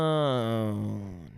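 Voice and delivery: low, soft, drawn-out alien word. Retold or imitated. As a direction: stretch it out in an alien croon. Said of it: "E T is touching the phone and says".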